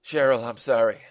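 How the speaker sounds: loud apology, a touch tearful. Acted. Husband apologizing to wife, mildly tearful. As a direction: apologize as if through tears. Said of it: "said through his tears".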